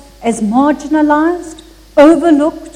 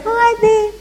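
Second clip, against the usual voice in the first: put-on baby echo; 9 semitones higher than usual. Baby echoing the old man.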